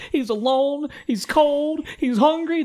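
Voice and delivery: high fretting mother voice; worried mom. Retold or imitated. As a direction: wail it like an anxious mom. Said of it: "The mom's like".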